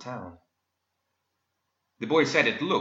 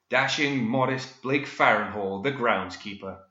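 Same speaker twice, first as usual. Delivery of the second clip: ordinary delivery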